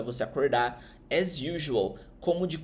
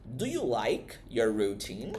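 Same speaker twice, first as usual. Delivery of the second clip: slow model question; 3 semitones lower, slower than usual. Shown as a demonstration. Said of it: "ela faz uma pergunta".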